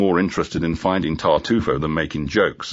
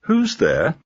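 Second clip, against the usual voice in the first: higher, honey-sweet question; seductive; 10 semitones higher than usual. A narrator's reading. Woman on the intercom asking who is there, seductive. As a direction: lure them in with the voice. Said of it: "her voice almost seductive".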